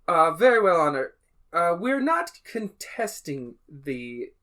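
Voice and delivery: NPC reply. Acted. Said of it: "she's like".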